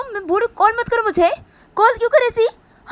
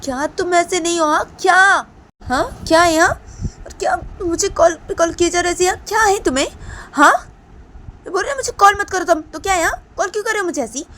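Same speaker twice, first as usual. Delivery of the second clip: put-on much higher, louder girl's shouted rant; angry shouting; 12 semitones higher, 9 dB louder than usual. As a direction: yell at him to stop calling. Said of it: "तो दीपांता बोलती".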